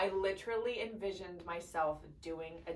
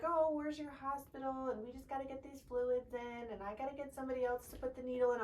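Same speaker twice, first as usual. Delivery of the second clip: higher, lightly put-on nurse voice; nurse's voice; 6 semitones higher, 5 dB quieter than usual. Mother imitates nurse fussing over fluids and needle, higher put-on voice. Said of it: "she's like".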